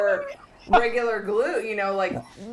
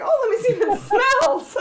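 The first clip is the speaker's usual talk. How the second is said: laughing; 7 dB louder than usual. Retold or imitated. Retelling sniffing the glue.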